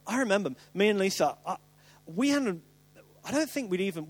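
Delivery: ordinary talk, nothing marked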